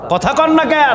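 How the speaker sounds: high, sudden shouted demand; calling out to crowd. Real, not acted. Preacher prods crowd to respond. (জনতাকে তাড়া দিয়ে উঁচু গলায় হাঁক)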